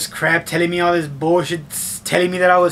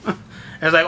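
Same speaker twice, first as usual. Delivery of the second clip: usual speaking voice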